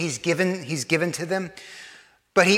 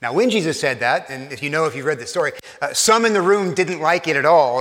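normal talking voice

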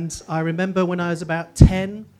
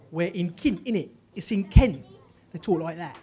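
casual Kent accent; Southeast English accent. Shown as a demonstration. Speaker demonstrates where they lived, Kent accent. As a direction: talk like a local from Kent. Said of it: "then I lived in the Southeast".

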